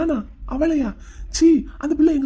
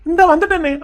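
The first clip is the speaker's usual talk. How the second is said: higher, louder call; calling back; 4 semitones higher, 5 dB louder than usual. Acted. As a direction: answer the call from afar. Shouting back to mother.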